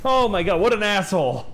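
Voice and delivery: high, loud exasperated cry; excited outrage. Real, not acted. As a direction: blurt it out in outrage. Outraged at the game cat.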